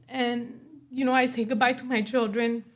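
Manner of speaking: ordinary delivery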